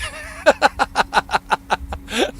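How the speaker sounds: high-pitched wheezy laughing fit. Real, not acted. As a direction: crack up at your own joke. Cracks up at his own joke.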